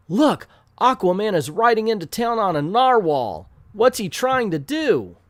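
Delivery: high-pitched excited comic-book bystander; astonished onlooker. Acted. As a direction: call out in hammed-up amazement. Onlooker calls out the sight.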